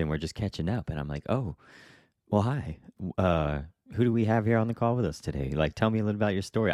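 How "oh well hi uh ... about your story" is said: higher-pitched, politely thrown greeting, re-enacted; polite awkwardness. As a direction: play the host greeting a too-familiar stranger. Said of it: "It's almost awkward in a way".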